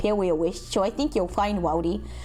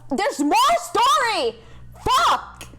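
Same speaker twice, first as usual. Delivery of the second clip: slow, loud frustrated yell; exasperated shout; 12 semitones higher, 4 dB louder, much slower than usual. Real, not acted. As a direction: groan it out at the screen. Frustrated outburst, story continues.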